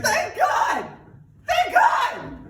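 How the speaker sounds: high, loud cry of relief; hammed-up desperate relief; shouting in relief. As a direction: scream your thanks as rescue comes. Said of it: "he's like".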